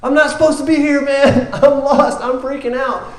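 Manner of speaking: comic panicked high voice; hammed-up panic. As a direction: act out a man freaking out in the back of the room. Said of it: "standing in the back of the room freaking out".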